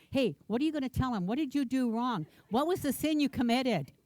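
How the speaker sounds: normal talking voice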